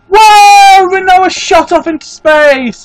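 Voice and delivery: sudden loud high whoa; startled yelp at the screen; a real jolt of surprise, loud and high; surprised whoa. Real, not acted. Character shoots into space.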